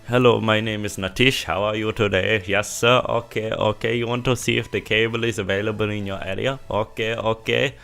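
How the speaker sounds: badly faked Indian call-centre accent; terrible mock Indian accent. Salesperson Natish passing a customer on to migration, mock Indian accent. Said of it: "I'm sorry for the terrible accent".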